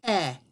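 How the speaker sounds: "eh" said as a short open e, like elephant; "eh" held short and open; short e sound. Shown as a demonstration. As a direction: model "eh" clearly for kids. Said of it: "let's test this sound".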